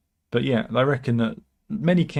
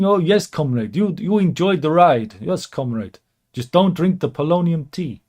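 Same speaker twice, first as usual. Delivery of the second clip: louder, higher mock-comrade voice; Putin; 5 semitones higher, 6 dB louder than usual. Putin inviting Kim to enjoy his car, hammed-up comrade voice. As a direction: play a hammed-up Russian leader, loud and swinging.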